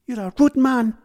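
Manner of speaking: high, loud petulant retort. Acted. Missus Galdini snapping at Gowser, high and loud. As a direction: spit it out huffily. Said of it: "petulant frown".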